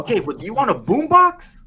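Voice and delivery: high, loud, laughing offer; tempting salesman. Retold or imitated. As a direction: dangle the prize at the customer. Salesman dangling the gift.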